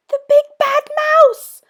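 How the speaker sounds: very high, loud frightened yell; yelled in fright. Acted. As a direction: scream as the shadow looms. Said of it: "yelled the Gruffalo's child".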